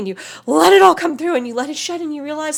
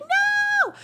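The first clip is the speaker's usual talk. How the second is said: put-on inner child's refusal. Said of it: "it's gonna be like".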